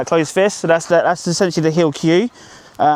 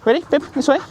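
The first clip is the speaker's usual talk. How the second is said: calling the dog; 11 semitones higher, faster than usual. Real, not acted.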